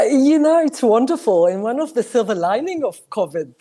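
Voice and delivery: ordinary talk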